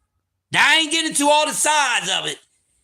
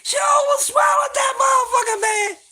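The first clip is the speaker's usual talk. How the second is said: incredulous shout; 12 semitones higher than usual. Real, not acted. Telling the crowd his girl almost swallowed it, incredulous shout. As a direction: shriek it in disbelief. Comic disbelief, high shout.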